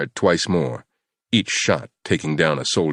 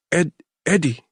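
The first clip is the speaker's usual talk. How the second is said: frightened; 12 semitones higher than usual. Acted. Terrified captive gives name.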